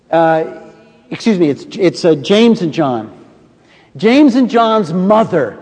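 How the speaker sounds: normal talking voice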